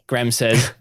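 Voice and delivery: ordinary delivery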